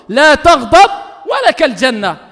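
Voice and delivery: loud, slow, stretched words; emphatic proclamation. Acted. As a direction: proclaim it to the crowd. Repeated forcefully after quiet take. (proclamation forte et lente)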